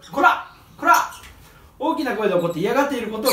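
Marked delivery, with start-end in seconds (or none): 0.1-1.1 sharp scolding こら; mock scold a biting parrot; scolding shout. Shown as a demonstration. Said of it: "一番、怒る。". (叱りつける声)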